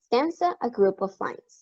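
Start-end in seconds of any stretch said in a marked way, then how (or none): 0.1-0.6 Stanza said clearly, higher, with a rising tune; term stressed. Shown as a demonstration. Teacher introducing the term, rising emphasis. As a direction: lift Stanza up as the new term. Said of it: "refer to it as stanza".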